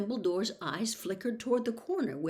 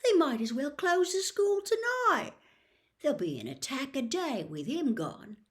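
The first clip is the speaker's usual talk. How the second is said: put-on alarmed boy; 7 semitones higher than usual. A narrator's reading. Ron's alarm.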